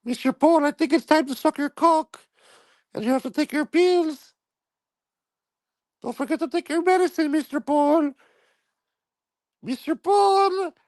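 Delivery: very high falsetto with a Spanish lilt; squeaky lovesick maid; mock maid voice. Maid Gloria pleading with her boss in high falsetto.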